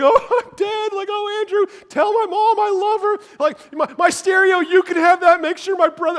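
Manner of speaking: put-on high, loud wailing child; hammed-up tearful last goodbye; mock crying. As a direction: wail like a kid who thinks he's dying. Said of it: "I remember crying".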